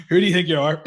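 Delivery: loud teasing put-down; mocking slagging. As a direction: slag her off, loudly. Mates slagging her off.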